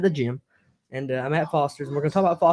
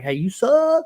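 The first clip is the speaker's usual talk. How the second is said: higher, louder playful mock taunt; mock jeer; 8 semitones higher, 5 dB louder, much slower than usual. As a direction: imitate a heckler, pitched up. Acting out an unwelcoming jeer.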